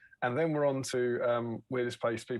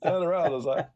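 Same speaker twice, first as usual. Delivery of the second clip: normal talking voice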